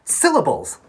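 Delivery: loud, high announcement of the key word; big reveal. Acted. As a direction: unveil the word like a game-show prize. Dramatic reveal of lesson topic.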